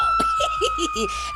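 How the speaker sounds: sudden shrill-pitched laugh burst; high squealed laugh. Real, not acted. Vlogger laughing at her own UK joke, high squeal.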